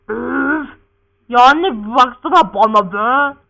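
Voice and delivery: loud, drawn-out plea to mother; acted child demanding attention; whiny protest. Son wanting mother to look at him, exasperated.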